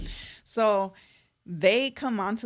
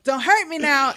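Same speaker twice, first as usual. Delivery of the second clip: higher, louder pleading whine; playful mock fear; 6 semitones higher, 8 dB louder than usual. [name] teasing the usher, playful mock-scared plea. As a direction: beg in jest not to be hurt. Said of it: "and he's like".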